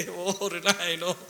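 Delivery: soft, slow laughing groan of pity; a soft amused sigh of pity. Real, not acted. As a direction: chuckle it out softly, pitying.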